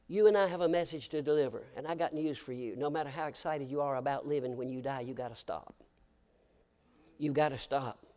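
usual speaking voice

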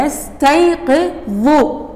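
يستيقظ said slowly with a heavy, emphatic ظ; strong ظ. Shown as a demonstration. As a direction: stretch يستيقظ and press the ظ hard. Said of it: "من ألفظها هي قوية". (نطق الظاء قوية مفخمة في يستيقظ)

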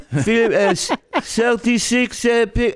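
high, halting mock Israeli accent; imitated Israeli accent, labouring over each word; struggling Israeli English. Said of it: "he starts with his English".

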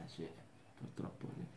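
whispered, despairing; resigned whisper. Acted. Sad man on the bench whispering his regret. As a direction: breathe it out in defeat.

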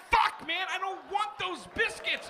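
exasperated complaint. Retold or imitated. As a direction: groan about the unwanted biscuits. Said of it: "I'm like".

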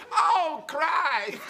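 high, loud laughing "Oh, Christ!"; mock alarm, laughing through it; retold shocked exclamation. Interviewer reacting as the light falls, laughing mock alarm.

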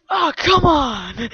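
loud, drawn-out cry of frustration; loud, slow, whiny protest; exasperated whine. Real, not acted. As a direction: wail it in game frustration. Exasperated at the game.

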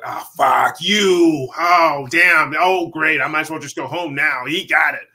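loud, drawn-out cursing in mock despair; frustrated outburst. Actor reacting to a casting agent, loudly exasperated. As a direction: groan it out loud, then grumble on.

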